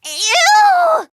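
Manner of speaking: high, loud squeal of disgust. Acted. Reacting to something gross, high loud ew.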